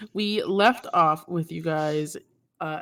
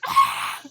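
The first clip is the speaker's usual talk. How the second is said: high shriek; 6 dB louder than usual. Real, not acted. Squeal at the table.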